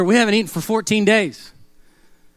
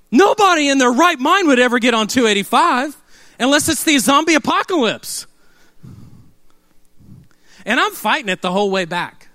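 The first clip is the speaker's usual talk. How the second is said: ordinary delivery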